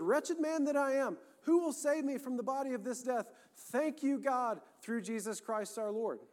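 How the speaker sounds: high, impassioned cry to God; calling out. Acted. Preacher voices Paul calling out to the Lord, impassioned. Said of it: "calling out to the Lord".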